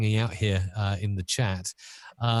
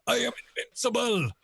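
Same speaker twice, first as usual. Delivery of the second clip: high, quick comic villain squeal; shrieked mock boast; Bond villain; 13 semitones higher, faster than usual. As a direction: pitch it way up, hammed-up. Another try at villain quote.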